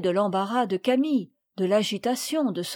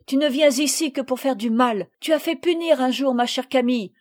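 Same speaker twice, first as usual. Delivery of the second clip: higher, louder indignant tirade; furious accusation; 4 semitones higher, 6 dB louder than usual. A narrator's reading. Marguerite accuses Sophie of hurting Camille, furious accusation. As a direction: hurl each reproach angrily. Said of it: "d'un œil enflammé de colère".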